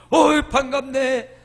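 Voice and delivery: meeting a friend on the street, loud hearty welcome; glad greeting. Retold or imitated. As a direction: greet an old friend warmly and broadly. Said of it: "반가운 사람 보면".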